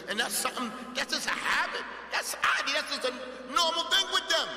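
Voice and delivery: loud, high, building emphasis; emphatic explaining. Real, not acted. Teacher describing memorizers' reading habit, loud and emphatic. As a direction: build to a high, forceful finish.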